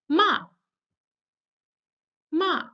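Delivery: "Ma" dropping sharply from high to bottom; fourth tone. Shown as a demonstration. Teacher demonstrates the fourth tone to students, "Ma" falling sharply. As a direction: say "Ma" like an angry command, pitch falling. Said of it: "it sounds like this".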